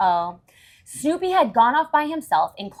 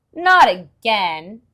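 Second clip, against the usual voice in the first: weary groan; 8 dB louder, much slower than usual. Acted. Charlie Brown gets a rock.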